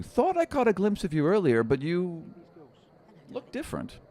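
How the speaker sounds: a sly little seeker teasing his find; halfling seeker. Acted.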